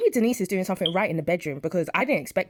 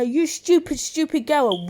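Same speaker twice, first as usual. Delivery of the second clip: louder, higher mock shout; imitating a mother yelling at her daughter; retold shout; 8 semitones higher, 3 dB louder, slower than usual. Mother yelling at daughter, imitated higher shout. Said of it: "Jean started shouting at her".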